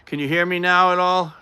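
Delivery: calling out. Real, not acted. Streamer testing if viewer can hear, calling out. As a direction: raise your voice to be heard.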